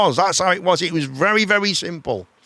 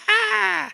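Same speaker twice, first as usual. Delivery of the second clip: high laugh. Real, not acted. Laughing at joke.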